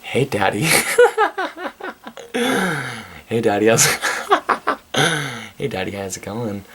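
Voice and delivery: cracking up mid-line while cooing "hey daddy"; giggling through a mock come-on; laughing flirty mock. Real, not acted. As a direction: try to do the flirty voice but keep breaking into laughter. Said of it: "She's just like".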